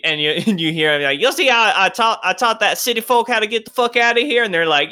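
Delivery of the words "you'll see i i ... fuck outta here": high, fast boasting bar tough; bragging patron. Acted. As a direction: show off to the crowd, loud. Patron brags to crowd.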